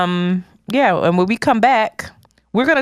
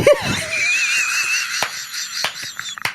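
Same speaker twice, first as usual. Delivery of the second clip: shrieking burst of laughter; 5 dB quieter than usual. Real, not acted. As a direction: howl with laughter.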